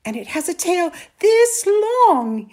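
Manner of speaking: high, loud, excited boast; insist, stretching the size with the voice; persistent. A narrator's reading. Boy shows mother the tail's size, insistent. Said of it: "Amal persisted".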